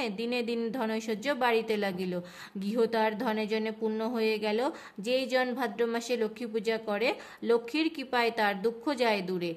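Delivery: rhythmic chanted verse reading; panchali recitation. Acted. Reading Lakshmi's vrata tale aloud, rhythmic chant. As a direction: chant the rhymed tale to the household.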